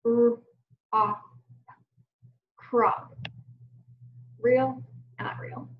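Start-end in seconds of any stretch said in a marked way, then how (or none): 0.1-1.2 R and ah sounded out as separate sounds; isolated phonemes, R ... ah; letter sounds. Shown as a demonstration. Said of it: "Let's try it again". 2.6-3.1 "crop" blended into one word. Shown as a demonstration. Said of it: "blend to make that word".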